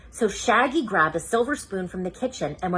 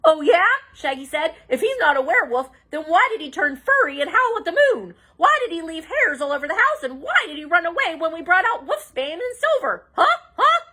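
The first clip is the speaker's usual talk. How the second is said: high, fast accusing questions; challenging Shaggy; 10 semitones higher, 4 dB louder than usual. Acted. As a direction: fire off the accusations.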